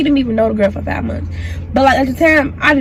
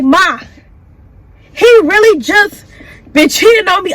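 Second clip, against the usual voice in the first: upset plea; 5 semitones higher, 5 dB louder than usual. Retold or imitated. Crying to his mother, can't breathe.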